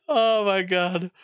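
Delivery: slow, high "oh my God" through laughter; gasping it out mid-laugh; real laughter carrying the words. Real, not acted. Still laughing at the face.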